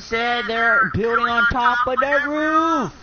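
loud, slow, high furious yell; angry shout. Real, not acted. Gamer yells at teammate who ignored his warning, angry shout. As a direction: bellow it, stretching each word.

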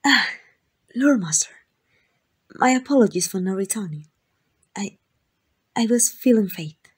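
flustered excuse. Acted. The royal caught by the Lord Master, flustered and nervous. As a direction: stammer out an excuse, caught off guard.

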